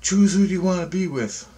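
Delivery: high, imitated female guide; dream woman's voice. She asks the dreamer to choose, high put-on voice. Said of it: "she goes".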